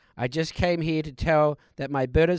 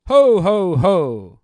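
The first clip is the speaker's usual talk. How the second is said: loud jolly Santa laugh; booming ho ho ho; 3 semitones higher, 10 dB louder, much slower than usual. Acted. Ghost of Christmas present announcing himself with a laugh. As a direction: belly laugh like Father Christmas.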